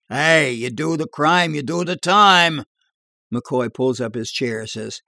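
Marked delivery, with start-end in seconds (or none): 0.1-2.6 raised, louder playful accusing voice; mock-stern, building louder to the end; mock accusation. A narrator's reading. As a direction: accuse him in jest, pitching it up. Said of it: "pointing his finger in mock accusation".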